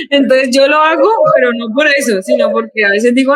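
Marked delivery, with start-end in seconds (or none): none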